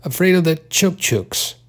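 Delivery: playful mock scolding of a pet. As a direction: tease the cat with chook-chooks. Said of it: "he said mockingly".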